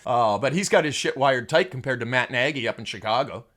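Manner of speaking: ordinary talk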